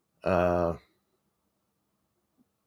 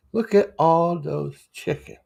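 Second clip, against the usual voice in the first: meme voice; 9 semitones higher, slower than usual. Pretend. Repeats meme in put-on voice.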